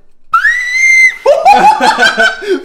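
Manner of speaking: very high, loud laughing shriek. Real, not acted. Helium flute makes him laugh.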